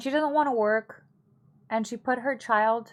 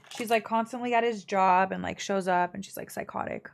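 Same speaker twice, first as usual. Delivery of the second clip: usual speaking voice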